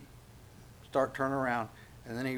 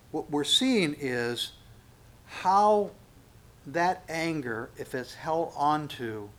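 slow emphasis; 2 semitones higher, 4 dB louder, much slower than usual. Real, not acted. Preaching emphasis slowing.

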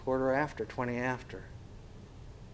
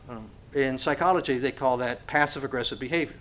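ordinary delivery

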